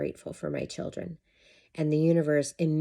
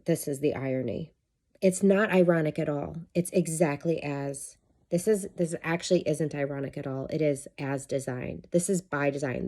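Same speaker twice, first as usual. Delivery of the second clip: ordinary delivery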